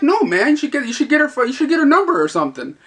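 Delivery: high, excited mock encouragement; teasing urging. Clerk tells pinched customer to get her number, excited teasing.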